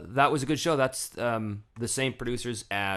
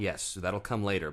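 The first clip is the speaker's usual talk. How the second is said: ordinary delivery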